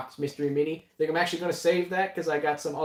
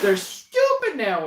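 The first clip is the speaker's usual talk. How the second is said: high, loud, drawn-out indignation with a laugh; mock offense; 11 semitones higher, 6 dB louder, slower than usual. Host teasing co-host who insulted the boxes, mock offense. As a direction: stretch it out in playful outrage.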